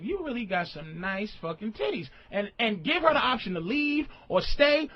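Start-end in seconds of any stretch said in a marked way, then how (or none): 0.0-2.1 soft, hushed come-on; acted low-key pickup line; dirty talk. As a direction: drop the volume for a sly dirty compliment. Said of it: "you say something dirty like".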